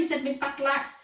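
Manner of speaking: ordinary delivery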